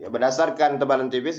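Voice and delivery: usual speaking voice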